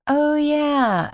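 high, drawn-out note of remembering; dawning realization. A narrator's reading. Diver to herself underwater, relieved realization.

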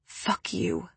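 a barely voiced whisper; whispered insult. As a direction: breathe the insult out. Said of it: "whisper the insult at him".

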